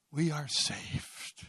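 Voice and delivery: low, soft and very slow; hushed solemn declaration. Real, not acted. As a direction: let it land quietly and reverently. Solemn climax after a pause.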